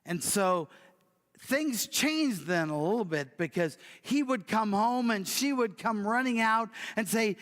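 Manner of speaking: ordinary talk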